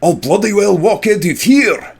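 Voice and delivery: high, loud Scottish defiance; defiant Scottish. Retold or imitated. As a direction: spit it out like a stubborn Highlander in a Scottish accent. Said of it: "his response is".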